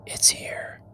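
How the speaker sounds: breathy hushed whisper, barely voiced. A narrator's reading. Monk to Guido Baldo, a hushed whisper. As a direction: whisper it like a dread secret. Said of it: "he whispered".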